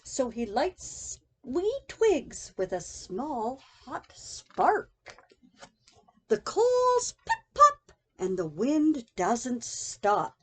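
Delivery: ordinary delivery, nothing marked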